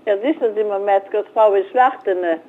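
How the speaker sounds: ordinary talk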